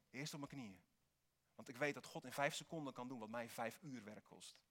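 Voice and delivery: normal talking voice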